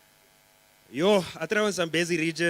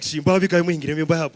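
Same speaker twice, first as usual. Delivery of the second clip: loud therapist; 8 dB louder than usual. Retold or imitated. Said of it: "He's just like".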